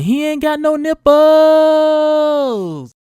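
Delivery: high, loud yell with a long-held last word; comic high-pitched holler, very drawn out; Kevin Hart. Retold or imitated. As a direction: yell back a taunt, stretching the end way out. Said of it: "your Kevin Hart voice saying".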